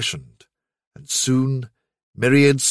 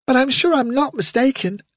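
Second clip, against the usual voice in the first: very high, puzzled little voice; tiny high-pitched youngster; puzzled young rabbit; 12 semitones higher than usual. A narrator's reading. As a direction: answer like an unsure bunny child. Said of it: "The little rabbit".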